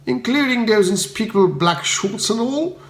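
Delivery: a touch of high-pitched disdain; lightly appalled Bertie. A narrator's reading. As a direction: scoff at the shorts.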